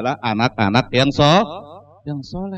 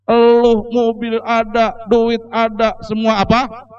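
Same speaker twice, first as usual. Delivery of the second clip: angry rich father; 8 semitones higher than usual. Retold or imitated. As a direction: scold the children for neglect. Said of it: "Wah, marah dia".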